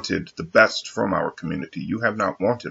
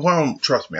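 higher mock reassurance; imitating coworker; 8 semitones higher than usual. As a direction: mimic her brushing it off. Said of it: "She goes".

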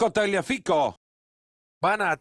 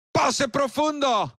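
excited call; 6 semitones higher, 3 dB louder, slower than usual. Acted. Play-by-play calling a pass.

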